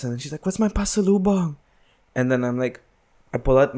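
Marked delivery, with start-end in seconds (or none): 0.4-1.5 imitating mom. Son voices mom asking gift.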